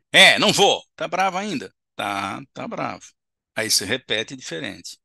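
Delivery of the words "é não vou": loud, high, curt refusal; furious spirit refusing again; angry spirit. Acted. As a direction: bark it out like a stubborn spirit.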